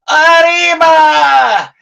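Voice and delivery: long, high, loud wrestler's yell; yelled war cry. Retold or imitated. Wrestler pulling the Sheik's legs up, long high yell. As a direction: belt out the cry and stretch it. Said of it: "he yells out".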